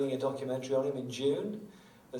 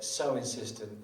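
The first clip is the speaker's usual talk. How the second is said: usual speaking voice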